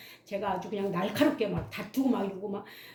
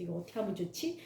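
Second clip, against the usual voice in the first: worried whisper; 3 semitones lower, 8 dB quieter, faster than usual. Retold or imitated. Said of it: "제가 막 떨렸어요".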